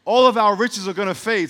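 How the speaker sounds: loud, high declaration; proclaiming. Real, not acted. Preacher declaring riches fade, loud and high. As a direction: ring it out to the church.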